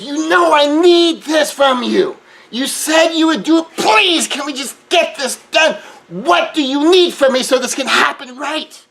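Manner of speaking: high, loud pleading demand; intense but kind. Shown as a demonstration. Pushing a loved one to get it done, loud and urgent. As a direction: shout the request but keep it caring. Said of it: "Still intense? Yeah".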